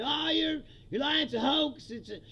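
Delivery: parroting the doubters; mock dismissal. People dismissing years of claims, mocking their protest.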